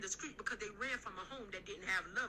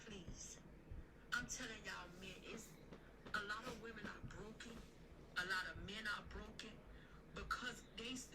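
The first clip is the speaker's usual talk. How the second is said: softer, sincere, almost confiding sermon; hushed preaching; 10 dB quieter than usual. Acted. Hushed counsel to listeners about loving a spouse. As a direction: lean in and plead softly with them.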